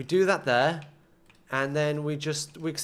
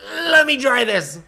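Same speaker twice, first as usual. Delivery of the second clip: sudden loud push, higher; loud burst; 8 semitones higher, 7 dB louder than usual. Real, not acted. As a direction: blurt it out loud and high. Frustrated gamer psyching up.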